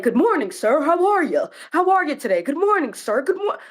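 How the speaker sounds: high, eager-to-please service voice; effusive greeting. Retold or imitated. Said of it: "The energy shifted".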